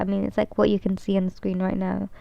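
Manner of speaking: normal talking voice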